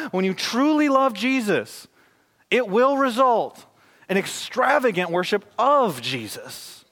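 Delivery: slow, high and weighty, punching "truly", "result" and "of"; emphatic preaching. Real, not acted. Preacher drives home his point to the congregation, emphatic on "truly", "result", "of".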